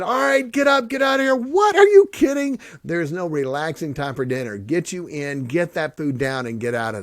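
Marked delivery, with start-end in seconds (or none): 0.0-1.3 high, loud shouted order; drill sergeant shout. Retold or imitated. Drill sergeant rushing recruits. 1.3-2.6 high, loud outraged disbelief; shocked disbelief. Retold or imitated. Recruit's shocked reaction.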